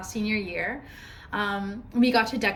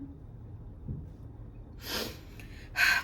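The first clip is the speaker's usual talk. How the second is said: long, voiceless exhale; heavy sigh; 5 dB quieter than usual. Acted. Putting aside her friend's death, long heavy sigh. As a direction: sigh it out before moving on.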